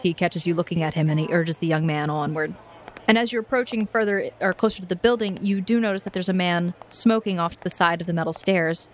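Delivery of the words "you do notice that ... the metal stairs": usual speaking voice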